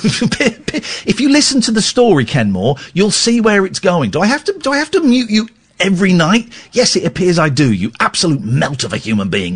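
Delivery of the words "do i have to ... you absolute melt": high, exasperated aside to a heckler; annoyed aside. Real, not acted. To the chat interrupting his story, exasperated. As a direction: tell off the interrupting listener. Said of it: "Listen, telling a story".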